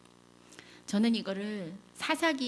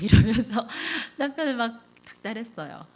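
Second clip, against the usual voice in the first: laughing through the words, louder; 4 semitones higher, 9 dB louder than usual. Real, not acted. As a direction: chuckle while confessing it. Laughs retelling nagging husband.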